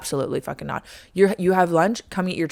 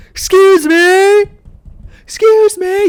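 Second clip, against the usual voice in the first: very high, loud, stretched excuse me; loud high panicked call; yelling excuse me; 11 dB louder, much slower than usual. Retold or imitated. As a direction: yell for help through the wall.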